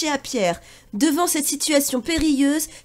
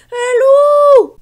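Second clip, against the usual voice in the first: very high, very loud scream; terrified shout; 13 semitones higher, 13 dB louder than usual. Acted. The cat screams at the wolf, very high and loud. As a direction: scream a warning in terror.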